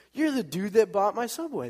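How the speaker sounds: high, eager mock exclamation; excited recognition. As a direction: imitate delighted surprise at spotting someone. Newcomer recognizes his benefactor.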